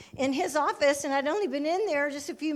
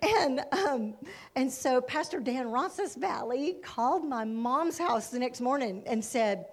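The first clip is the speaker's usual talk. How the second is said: normal talking voice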